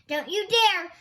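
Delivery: loud, high warning yell. Acted. Thorndyke yelling a warning at Nelson, loud. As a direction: yell a threat. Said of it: "Thorndyke yelled".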